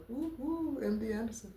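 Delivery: high little woo, then the name; excited cheer. Real, not acted. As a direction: cheer the hospital name softly.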